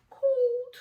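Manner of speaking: high, loud cry of Cold; big shivery cold. Acted. Telling children how cold the cave was, loud and high. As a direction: shout it out, shivering.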